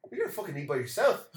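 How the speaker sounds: high, loud incredulous local; mock disbelief. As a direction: shoot it up in disbelief.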